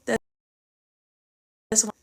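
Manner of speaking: ordinary delivery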